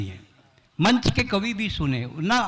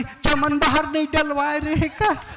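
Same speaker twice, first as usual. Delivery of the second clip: mock bride; 8 semitones higher than usual. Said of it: "मूल पान में डाली".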